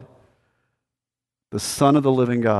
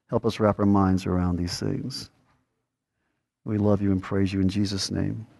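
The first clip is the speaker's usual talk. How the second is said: softer, lower prayer voice; closing prayer; 3 semitones lower, 3 dB quieter than usual. Acted. Pastor praying for the congregation, soft and low. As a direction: pray quietly to close the sermon.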